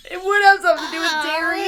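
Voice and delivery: cracking up mid-sentence; squeaky laughing voice, breaking. Real, not acted. Laughing through the line.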